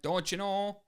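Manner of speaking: playful Wisconsin Midwest accent demo; Canadian-Midwest accent. Shown as a demonstration. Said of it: "Canadian, Wisconsin, Midwest accent".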